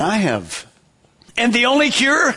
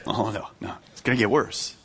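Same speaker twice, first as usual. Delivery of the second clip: hushed warning; 10 semitones lower, 8 dB quieter than usual. Real, not acted. Pastor answers imagined listener.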